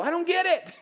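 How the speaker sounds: high, puzzled complaint; mock bewilderment; confused. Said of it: "we go".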